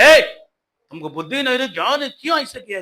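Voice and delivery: loud, fast fatherly scolding. Acted. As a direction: scold the boy like an angry father. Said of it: "डांटने". (गुस्से में डांटता हुआ पिता)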